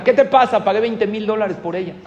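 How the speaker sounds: loud, high burst of outrage; angry scolding. Acted. Father to son who broke his expensive purchase, outraged scolding. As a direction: explode at the child, then press the point.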